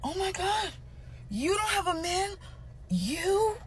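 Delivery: very high-pitched mock astonishment; hammed-up squeal of disbelief; shocked. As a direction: imitate men who can't believe it. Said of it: "They be so shocked".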